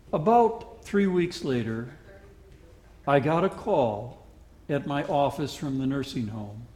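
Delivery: ordinary talk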